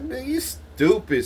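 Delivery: slow, high mocking scoff; high scoffing put-down. Mocking a friend's dumb robbery plan, slow high scoff. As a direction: drag it out like he's an idiot. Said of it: "And I was like".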